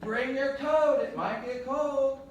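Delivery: high, loud mom voice; nagging mom. Acted. Mom telling them to bring a coat, high mom voice. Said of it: "kinda like their mom".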